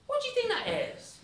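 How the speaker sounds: high-pitched wondering question; acted puzzlement. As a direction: ask in exaggerated bafflement. Hans feigns puzzlement while aiming.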